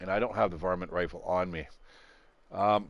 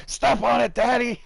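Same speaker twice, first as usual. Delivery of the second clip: high, loud mock kid yell; imitating excited son; 13 semitones higher, 6 dB louder than usual. Son urging Daddy to step on it, mock child's yell. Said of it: "my son's like".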